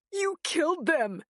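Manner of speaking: high accusing shout. A narrator's reading. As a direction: shout it out in anguish. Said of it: "he shouted".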